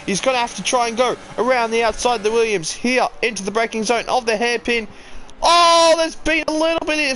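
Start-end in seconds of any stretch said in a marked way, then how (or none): 5.4-7.1 sudden loud gasp of alarm; surprised shout. Real, not acted. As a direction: yelp as the cars touch. Sudden crash during race.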